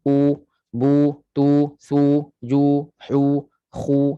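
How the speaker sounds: U, bu, tu, su, ju, hu, khu with pushed-out lips; U, bu, tu, su, ju, hu, khu, lips rounded forward; rounded lip letters. Shown as a demonstration. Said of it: "Bila baris depan baru muncung".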